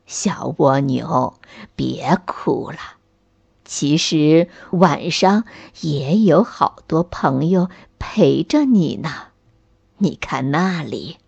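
put-on gentle grandfather soothing a child; old tree grandpa voice.

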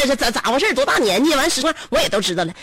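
ordinary delivery

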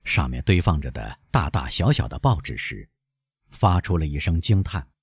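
usual speaking voice

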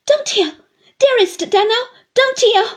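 high, loud, tearful begging; cling to him and cry out fervently. A narrator's reading. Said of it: "cried Missus Gummidge fervently".

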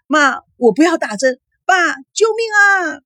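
very high cry for help; frightened plea against the needle; panicked cry. A narrator's reading. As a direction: call desperately to your parents. Daughter resisting injection, calling parents.

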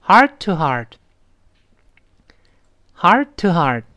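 "heart-to-heart" drawn out very slowly; slow model reading. Shown as a demonstration. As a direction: take "heart-to-heart" bit by bit for learners. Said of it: "慢慢来". (慢慢领读“heart-to-heart”)

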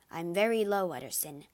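plain reading voice

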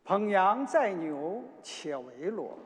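ordinary delivery

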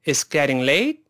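正常语速连贯读，late上扬; 比慢读利落地连读，句尾挑起; 快一点. Shown as a demonstration. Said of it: "快点试试看". (快些示范，一口气读完)

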